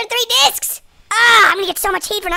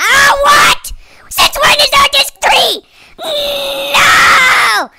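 loud dismayed outburst; dismayed shout; 5 semitones higher, 9 dB louder, much slower than usual. Real, not acted. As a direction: yell out in dismay. Discovers a third disc, dismayed.

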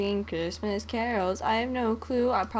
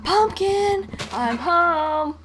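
high, loud homecoming call; mock yell; 7 semitones higher, 8 dB louder, much slower than usual. Jokes about greeting a pumpkin, yelling loud and high. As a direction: belt it out as you walk in the door. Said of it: "you just yell".

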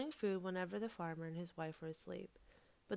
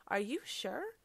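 louder, higher rising query; alarmed question; 5 semitones higher, 5 dB louder, slower than usual. A narrator's reading. Fox checks on the rain of fire, alarmed. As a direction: ask in alarm, voice climbing.

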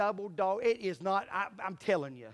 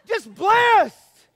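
high, loud, falling cry; exultant; 10 semitones higher, 12 dB louder than usual. Real, not acted. Preacher exulting before the church, loud. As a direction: exult in the blessing.

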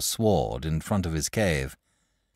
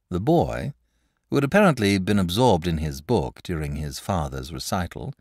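plain reading voice

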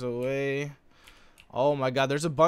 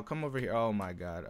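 ordinary delivery

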